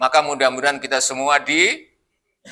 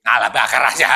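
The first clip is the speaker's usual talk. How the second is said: defiant dare; 6 semitones lower, faster than usual. Acted. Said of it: "Kira-kira Ibrahim nantangin apa lari".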